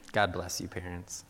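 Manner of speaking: ordinary talk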